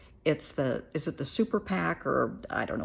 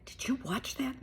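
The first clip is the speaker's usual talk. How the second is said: hushed, breathy whisper of a friend asking; whispered question; 8 semitones higher, 6 dB quieter than usual. Retold or imitated. A friend in the UK whispers about the debate, hushed. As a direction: lean in and whisper it like gossip.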